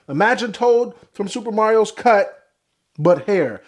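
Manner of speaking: ordinary delivery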